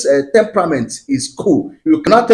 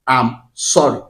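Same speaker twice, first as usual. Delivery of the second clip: slow, lower apology, word by word; model apology; 5 semitones lower than usual. Shown as a demonstration. Teaching couples how to say it, slow model apology. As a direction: spell out the apology slowly for learners. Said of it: "learn how to say".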